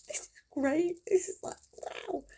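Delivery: high excited wonder; childish delight. Retold or imitated. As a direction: think it like a thrilled kid with money. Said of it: "you used to think".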